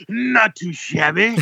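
high, slow, drawn-out cheery reply; goofy imitation of the cashier; enthusiastic clerk. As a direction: sing it out like an overeager cashier. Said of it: "He goes".

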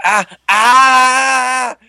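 high, loud "ah, aah" cries; yelping, then one long wail; mock pained scream. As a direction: imitate a helpless man's screams. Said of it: "He's like".

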